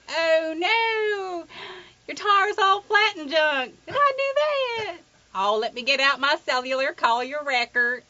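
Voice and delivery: squeaky high comic commercial character, drawn out; Geico pothole voice. Retold or imitated. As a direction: ham up a goofy cartoon pothole from an ad. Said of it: "one of my favorite commercials".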